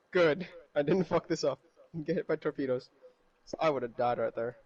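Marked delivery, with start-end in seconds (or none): none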